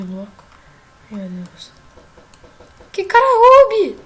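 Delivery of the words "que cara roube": high, loud panicked yelling; panicked shout. Real, not acted. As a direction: yell in alarm as the attack hits. Panicked shouting during game attack. (gritando em pânico)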